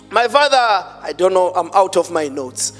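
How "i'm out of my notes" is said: ordinary talk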